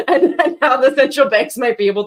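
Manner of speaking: laughing through the words. Real, not acted. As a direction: chuckle while saying it. Laughing while describing control.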